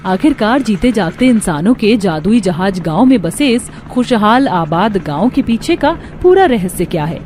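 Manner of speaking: ordinary delivery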